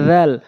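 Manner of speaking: জাল as a light Arabic dh with spread lips; light, open "dhaal" for জাল; spread mouth. Shown as a demonstration. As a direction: widen the mouth on জাল, tongue tip at the teeth. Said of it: "মুখ ওসার করে".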